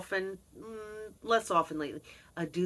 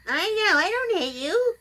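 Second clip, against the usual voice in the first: high pet voice; talking to cats; 13 semitones higher, 8 dB louder than usual. Real, not acted. Woman talking to her cats in a high pet voice. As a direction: coo high to the cat.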